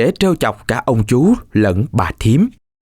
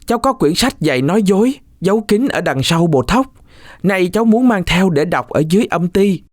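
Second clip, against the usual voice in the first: put-on higher, pleading boy's voice; pleading cry; 7 semitones higher than usual. Cuội in the basket begs his uncle and aunt, pleading. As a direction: beg for one last favour. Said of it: "Cuội kêu lên".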